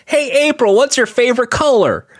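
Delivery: high, put-on friendly voice; birthday skit character. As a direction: play a high-voiced card giver. Acts out birthday card skit.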